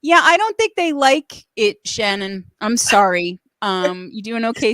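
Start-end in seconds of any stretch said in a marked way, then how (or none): 0.0-1.8 loud, high teasing irony; playful irony. Real, not acted. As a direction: tease with mock doubt, pitched up and loud. Teasing irony after rave comments.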